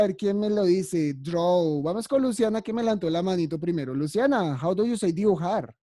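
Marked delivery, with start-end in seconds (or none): none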